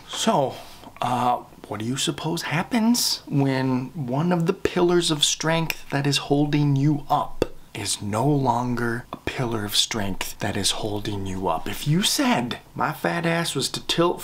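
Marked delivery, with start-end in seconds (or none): none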